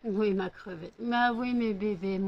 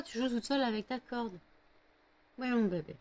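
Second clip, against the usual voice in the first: ordinary delivery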